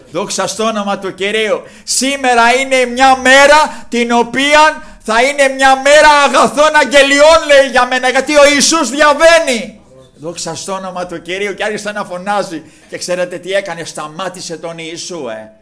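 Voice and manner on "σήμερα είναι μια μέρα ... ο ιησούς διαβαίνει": loud, high proclamation; full-force preaching. Real, not acted. Blind man's good news as Jesus passes, full-force preaching.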